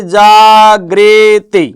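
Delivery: जागृति said slowly, each syllable clear, गृ as "gri"; drawn-out classroom model of जागृति; teacher modelling जागृति slowly; slow careful spelling-out. Shown as a demonstration. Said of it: "ये शब्द बनेगा ऐसे".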